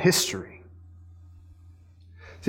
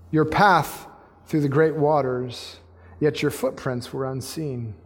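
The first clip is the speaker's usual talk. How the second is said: reverent scripture reading; 4 semitones lower, slower than usual. Real, not acted. Preacher closing the psalm about God's unseen path, hushed reverence. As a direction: wind down gently, phrase by phrase.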